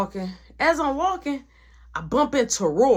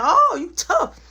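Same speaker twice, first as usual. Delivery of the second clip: mocking taunt; 9 semitones higher, 5 dB louder, slower than usual. [name] taunting during street confrontation.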